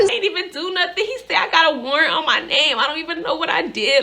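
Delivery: high, acted panicked wail; freaking out. Driver telling friend of the warrant, freaking out. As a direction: protest in high-pitched mock hysterics. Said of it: "Like, I'm freaking out".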